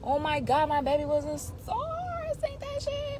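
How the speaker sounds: high, loud, thrilled exclamation; real high-pitched proud excitement; excited pride. Real, not acted. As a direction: cry out in proud amazement, voice soaring. Exclaims over baby being a star.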